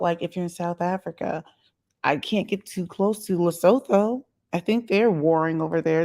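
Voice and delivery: ordinary delivery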